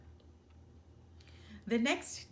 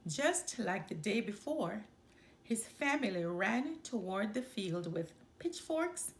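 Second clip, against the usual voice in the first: usual speaking voice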